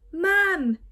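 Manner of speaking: calling out loud for a lost mum; shout. Acted. Boy alone on island, shouting for mum. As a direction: shout it across the empty beach. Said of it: "he shouted".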